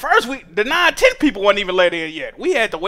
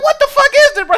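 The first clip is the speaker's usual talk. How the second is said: pitched way up, louder, baffled; incredulous squawk; 6 dB louder than usual. Retold or imitated. Reacting to auntie's blurry ID pictures, a high incredulous squawk. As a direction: squeal it in disbelief at the photos.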